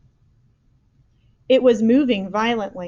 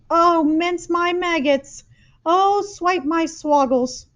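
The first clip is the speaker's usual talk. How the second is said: higher and slow, wailing dismay; anguished cry; 8 semitones higher, 4 dB louder, slower than usual. A narrator's reading. Giant hearing the nightmare, wailing. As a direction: wail the curses out. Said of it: "he cried".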